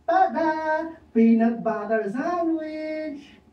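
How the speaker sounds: high, slow showman's reveal; proud reveal. Acted. Juan grandly reveals his sandwich to Pedro, high and slow. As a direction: unveil it grandly.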